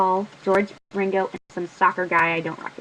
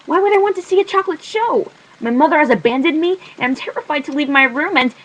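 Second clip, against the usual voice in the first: distraught outburst; 7 semitones higher, 6 dB louder than usual. Acted. Upset girl protests, near tears.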